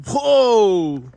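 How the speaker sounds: loud startled whoa; surprised. Real, not acted. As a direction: burst out in surprise. Sudden view of a big stream.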